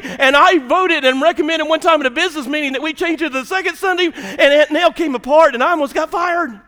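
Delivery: loud, high-pitched preacher's tirade; exasperated church-story rant, building to a shout; worked-up rant. Real, not acted. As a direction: hammer out each petty rule at full volume. Comic rant about church flower feud.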